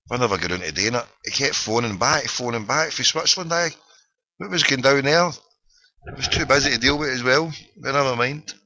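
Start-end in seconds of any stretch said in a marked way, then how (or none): none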